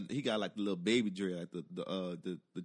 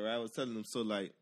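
ordinary delivery

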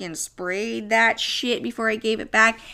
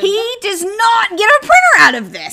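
loud, high, hammed-up refusal; mock defiant yell; 6 semitones higher, 8 dB louder than usual. Retelling her vow about the ex's printer, mock defiant shout. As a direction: shout it like a stubborn ex.

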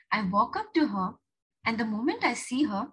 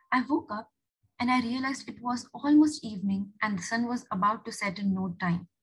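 usual speaking voice

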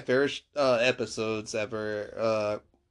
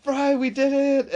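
excited cheer; 14 semitones higher, 4 dB louder, slower than usual. Retold or imitated. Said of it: "And she's like".

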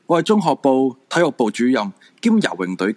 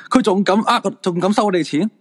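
higher, indignant outburst; indignant scoff; 9 semitones higher than usual. Acted. Husband scoffing at the medium to his wife, indignant. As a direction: scoff in disbelief.

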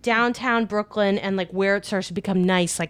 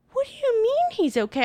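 very high, incredulous question; baffled. Retold or imitated. Wife confused by her friend's worry, baffled. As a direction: squeak it out in disbelief.

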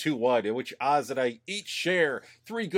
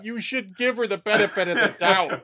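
mock indignation; 7 semitones higher than usual. Hosts improvise as offended Canadians, high mock indignation. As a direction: drag it out, pitched up and laughing.

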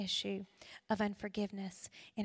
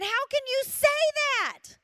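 high, loud stunned cry; shocked outcry; 14 semitones higher, 10 dB louder than usual. Retold or imitated. Daughter stunned by her mother's forgiveness, high shocked outcry. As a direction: blurt out disbelief.

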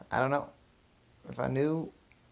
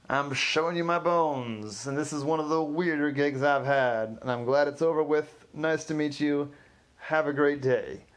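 singing telegram guy hamming it up as a lion; 2 semitones higher, 4 dB louder than usual. Acted. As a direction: roar the riddle like a costumed lion. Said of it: "lion signs off".

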